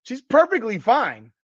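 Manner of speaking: ordinary talk, nothing marked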